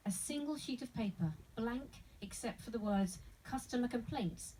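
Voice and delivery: usual speaking voice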